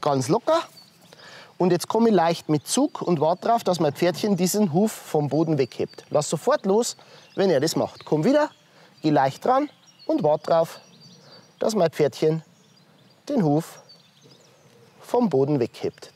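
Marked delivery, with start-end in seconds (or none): none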